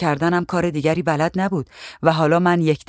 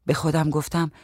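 ordinary delivery, nothing marked

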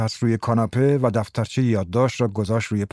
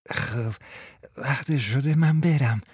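hoarse voice; 4 dB quieter, slower than usual. Acted.

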